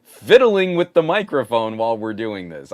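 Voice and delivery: exasperated laugh. Real, not acted. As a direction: blurt it out, half laughing. Exasperated outburst about mic complaints.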